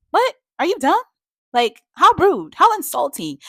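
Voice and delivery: high, loud outraged questioning; indignant disbelief. Acted. Reviewer telling off a character for an insulting offer, appalled. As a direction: raise pitch and volume, appalled.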